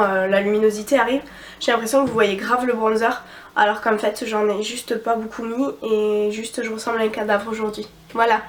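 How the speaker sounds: normal talking voice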